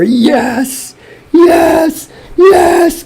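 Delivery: repeated high yeses, mounting in joy; triumphant cheer. Real, not acted. Fan hailing Brazil as Oscar winner, triumphant. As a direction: celebrate harder with each yes.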